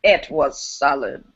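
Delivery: put-on slow old lady poet voice; lightly voiced old poet, very slow; Maya Angelou. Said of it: "solid Maya Angelou, old lady voice".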